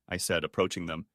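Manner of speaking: ordinary delivery, nothing marked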